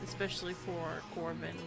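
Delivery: ordinary talk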